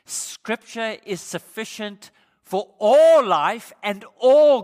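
loud, slow, emphatic pulpit delivery; full-force preaching. Real, not acted. Preacher driving home to the congregation that Scripture is enough. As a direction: pound each phrase home.

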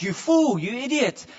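loud, high angry outburst; mock furious yell at a brother; enraged insult. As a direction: hurl it in a fit of rage. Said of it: "in a fit of rage".

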